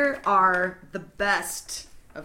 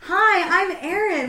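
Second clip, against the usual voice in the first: put-on kid voice; 14 semitones higher, 10 dB louder, slower than usual. Adults acting as kids.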